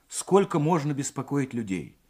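plain reading voice

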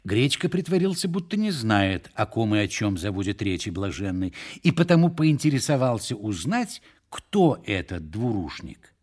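usual speaking voice

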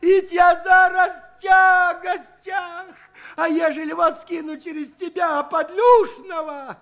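high, loud keening howl; wailing lament. A narrator's reading. Pregnant Aniska wails at Shchukar, high and keening. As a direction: wail it like a village lament. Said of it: "с подвывом заголосила".

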